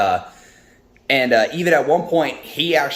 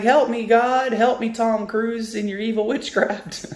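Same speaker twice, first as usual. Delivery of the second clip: high-pitched movie-quote panic; Ricky Bobby; 11 semitones higher than usual. Retold or imitated. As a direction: beg for help in hammed-up hysteria. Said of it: "Ricky Bobby from Talladega Nights".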